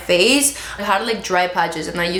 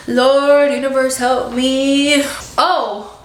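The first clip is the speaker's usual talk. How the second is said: dramatic plea; 3 semitones higher, much slower than usual. Pretend. Mock prayer for discipline.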